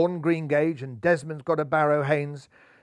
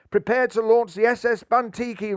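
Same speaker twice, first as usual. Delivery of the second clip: loud, high rallying roar; 6 semitones higher, 5 dB louder than usual. A narrator's reading. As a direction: roar it out like a launch order. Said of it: "roared the bunnies".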